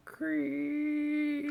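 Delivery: long high soft creak; creaking door. Retold or imitated. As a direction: stretch it into a thin, high creak. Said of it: "you even kind of touch it".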